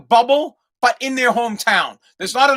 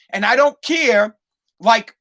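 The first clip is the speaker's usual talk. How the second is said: normal talking voice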